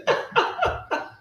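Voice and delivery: breathy high-pitched giggle; a burst of squeaky laughter. Real, not acted. Host amused by chat, high giggle.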